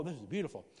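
ordinary talk, nothing marked

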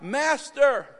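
high, loud cry to a master. Acted. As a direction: call out as if begging for help. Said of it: "And they cry out".

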